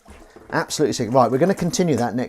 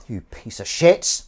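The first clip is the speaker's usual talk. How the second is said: ordinary delivery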